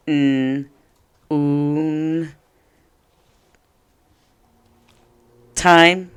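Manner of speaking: n, oon, time split into separate sounds; sounding out. Shown as a demonstration. Teacher sounding out noontime for students. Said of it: "Did you say".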